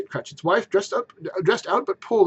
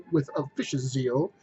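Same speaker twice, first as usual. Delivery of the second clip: ordinary delivery, nothing marked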